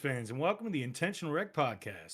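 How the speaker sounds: loud, high show-opening welcome; announcer voice. Acted. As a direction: belt out the podcast intro like a host. Opens the podcast as host.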